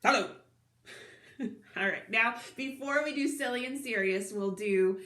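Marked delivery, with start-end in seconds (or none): 0.0-0.3 short, straight hello with no wobble. Shown as a demonstration. Showing children the opposite of wobbly, straight and short. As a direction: say it in one quick, even shot. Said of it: "as straight as we can".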